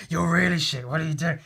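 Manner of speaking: loud, jeering goblin taunt; bully goblin shouting at her; big goblin voice. Retold or imitated.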